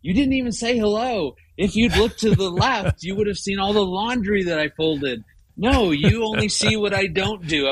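high, exasperated pitch, voice up the whole way; mock-indignant rant, pitched well up; indignant inner rant. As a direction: play the wounded husband fuming inside. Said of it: "In my mind, I'm like".

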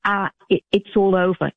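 ordinary talk, nothing marked

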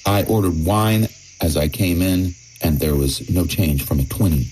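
ordinary delivery

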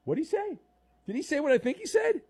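high-pitched disbelieving question; incredulous. Real, not acted. Host questions the caller's odd claim, incredulous. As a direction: ask as if you can't believe your ears.

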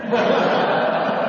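a quick voiceless giggle that rises; airy laugh. Real, not acted. Author laughing at the stranger's parting words. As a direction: chuckle helplessly at the line.

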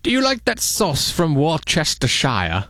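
hammed-up British movie-star voice; grand, put-on British accent; mock British accent. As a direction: play it as a fancy British actor. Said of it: "you have to say it like that".

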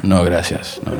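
low soft mock Spanish accent; broken Spanish. Seagal refusing in bad Spanish, low soft. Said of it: "horrifically broken Spanish".

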